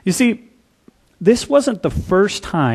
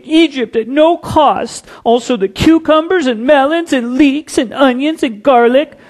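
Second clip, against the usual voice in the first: high, loud, whining complaint; whiny voice; 10 semitones higher, 5 dB louder than usual. Retold or imitated. Preacher voicing Israelites craving Egypt's food, whiny. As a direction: whine like a spoiled child about the food. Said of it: "We just skip the whiny voice".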